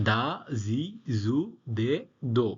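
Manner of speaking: "দা, জি, জু, দে, দো" as separate syllables, voiced d and z sounds; voiced kana demo. Shown as a demonstration. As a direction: recite "দা, জি, জু, দে, দো" one by one as the voiced Japanese da row. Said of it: "আর তা line কি হবে?".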